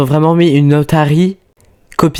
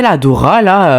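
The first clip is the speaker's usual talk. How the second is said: ordinary talk, nothing marked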